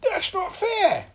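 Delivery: high, slow, loud protest; indignant cry. A narrator's reading. Mister Tickle protests his shrunken arms, high indignant cry. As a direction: wail it out in protest. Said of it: "he cried".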